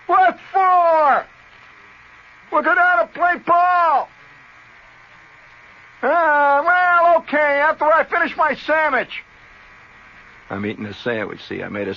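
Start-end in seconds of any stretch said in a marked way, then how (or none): 0.0-1.2 loud high shout from inside the house; hollering back. Retold or imitated. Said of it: "You holler from inside the house". 2.5-4.1 slow, loud, high call; buddy hollering from the back yard. Retold or imitated. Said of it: "You holler from inside the house". 6.0-9.2 loud high shout from inside the house; hollering back. Retold or imitated. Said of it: "You holler from inside the house".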